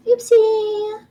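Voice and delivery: squeaky playful oops; high-pitched oopsie. Real, not acted. Fortune teller misdeals cards.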